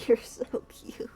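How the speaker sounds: fond little whisper. Real, not acted. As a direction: whisper adoringly to the cat. Whispering to cat.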